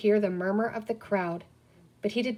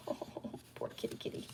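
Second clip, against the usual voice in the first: whispered pity for the cat; 17 dB quieter than usual. Real, not acted. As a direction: breathe it out softly to the cat. Aside to the cat.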